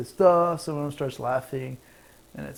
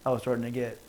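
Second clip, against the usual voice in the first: normal talking voice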